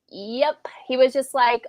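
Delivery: usual speaking voice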